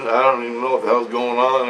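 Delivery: ordinary delivery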